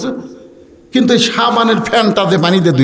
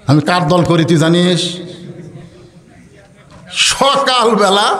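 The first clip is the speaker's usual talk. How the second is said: ordinary talk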